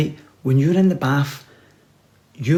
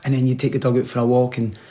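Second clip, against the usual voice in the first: ordinary delivery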